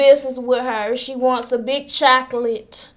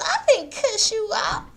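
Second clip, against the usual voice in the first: higher, lightly voiced denial; a mock high innocent denial; playing Joyce with a high, innocent voice; Joyce denying; 10 semitones higher than usual. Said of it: "Joyce says".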